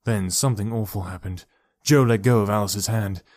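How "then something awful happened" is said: plain reading voice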